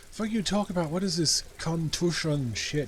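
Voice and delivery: put-on high, indignant street-kid voice; a brat taking offence; annoyed kid Kuno. Kuno snapping at the detective, high and irritated.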